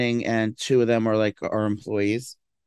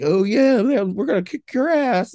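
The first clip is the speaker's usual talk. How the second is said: higher, louder hammed-up bravado; mock tough fighter; 9 semitones higher, 3 dB louder, slower than usual. Complaining about performing as ring girl, mock tough bravado. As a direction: ham up the fighter hype. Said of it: "you have to act like".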